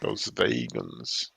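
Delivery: "vegans" as VAY-guns, low, soft and drawn out; demonstrated pronunciation. Shown as a demonstration. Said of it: "a little more swing to it".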